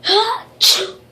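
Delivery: loud forced sneeze; fake sneeze. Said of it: "I gotta make myself sneeze".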